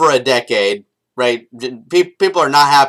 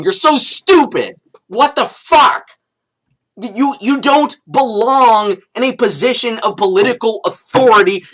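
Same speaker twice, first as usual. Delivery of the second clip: higher and louder, dragging out the insults slowly; angry rant; 7 semitones higher, 3 dB louder than usual. Real, not acted. Vlogger berating the president over funds, slow furious rant. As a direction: berate him like he's the dumbest man alive.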